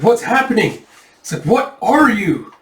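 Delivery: high, alarmed acted cry; horrified bandit, pitched well up; terrified questions. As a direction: demand answers in rising panic.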